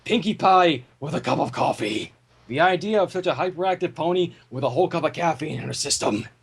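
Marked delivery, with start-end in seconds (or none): none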